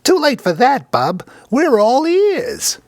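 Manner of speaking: wisecracking pet parrot squawks at Althea, very high and loud; squawking parrot. Acted.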